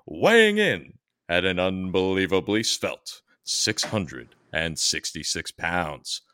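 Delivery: slow booming ring announcer intro. Acted. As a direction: announce him like a wrestling entrance.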